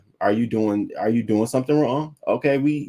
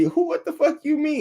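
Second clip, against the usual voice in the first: outraged disbelief. Retold or imitated. Twitter users react to the tweet, with outraged high disbelief. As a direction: pitch leaps way up in disbelief. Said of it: "People was like".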